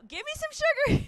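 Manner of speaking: high, put-on old granny voice; grandma voice. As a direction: croon it like a clingy old grandma. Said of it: "grandma voice recalled".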